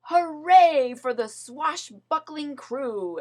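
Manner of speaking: loud, high cheer; the crew cheering their treasure; loud triumphant cheer. A narrator's reading. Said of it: "everyone cheered".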